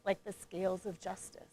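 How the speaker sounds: quiet workshop reflection voice; guided reflection. Acted. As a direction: ease the room inward, gently.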